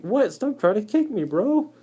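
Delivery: high, loud mock outrage; hammed-up indignant spider; indignant garden spider. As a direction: protest the kicking, bro-style.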